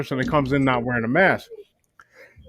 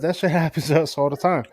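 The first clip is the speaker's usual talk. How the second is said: ordinary delivery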